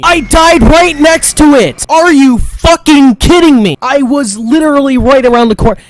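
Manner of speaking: loud, high outraged yelling; furious shout. Real, not acted. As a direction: explode at the unfair death. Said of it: "how many times I fucking raged".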